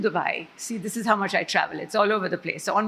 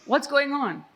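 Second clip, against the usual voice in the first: higher, prying friendly concern; nosy concern; 5 semitones higher than usual. Retold or imitated. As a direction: lean in like a nosy fellow passenger. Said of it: "like any Pakistani who has no idea of personal space".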